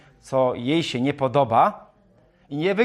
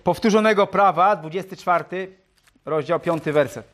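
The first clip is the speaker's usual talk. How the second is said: louder, higher repeat of the reference; speaking up; 4 semitones higher, 4 dB louder than usual. Real, not acted. Preacher repeats chapter and verse for listeners, speaking up. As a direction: announce it clearly so the whole room hears. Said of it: "Jakbym mówił cicho, to mów".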